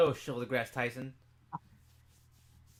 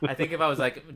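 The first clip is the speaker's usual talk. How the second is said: ordinary talk, nothing marked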